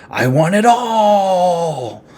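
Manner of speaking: high, slow, drawn-out hammy cry; greedy cry. Retold or imitated. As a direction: stretch it out like a greedy villain. Young Freddy voiced answering demons.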